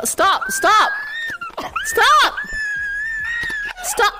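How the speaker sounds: high, insistent shouts of protest; urgent shouting. Real, not acted. Streamer tells someone to stop flashing the camera, high shouting. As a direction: yell for them to quit it.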